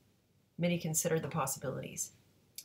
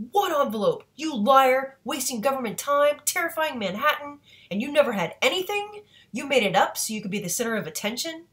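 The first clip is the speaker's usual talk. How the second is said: loud, high accusatory shout; yelling; 8 semitones higher, 8 dB louder than usual. A narrator's reading. Guys in dark suits berating Mitty for wasting time, yelling. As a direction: yell it in outraged accusation. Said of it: "They'd yell".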